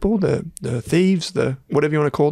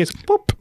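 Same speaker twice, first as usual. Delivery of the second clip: popping noise imitation. Shown as a demonstration. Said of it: "that really weird popping noise".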